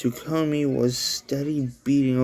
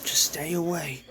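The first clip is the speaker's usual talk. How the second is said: higher, softer breathy plea; fearful plea; 5 semitones higher, 4 dB quieter than usual. Acted. Thompson dropping to the floor before Tsukumo, fearful whispered plea. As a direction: push her back with a frightened whisper. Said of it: "cried Thompson in fear".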